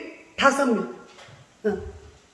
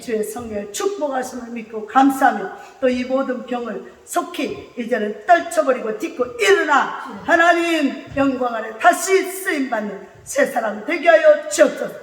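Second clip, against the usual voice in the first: 점점 고조되는 외치는 기도; 목소리를 높여 간구하듯; 열정적인 기도; 3 semitones lower, 5 dB louder than usual. Real, not acted. Prayer rising to fervent shout. (점점 뜨거워지는 기도)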